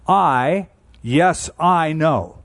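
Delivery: "I" hit loud, then "yes, I know" slow and heavy; emphatic form. Shown as a demonstration. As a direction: stress "I" and drag out "yes, I know". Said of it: "it's put in the emphatic form".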